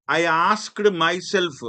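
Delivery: slow, deliberate classroom reading of the sentence; slow example reading. Shown as a demonstration. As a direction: read it out slowly for students. (నెమ్మదిగా ఉదాహరణ చదవడం)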